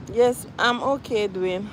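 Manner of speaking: ordinary talk, nothing marked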